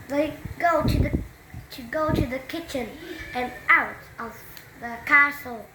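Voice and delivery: usual speaking voice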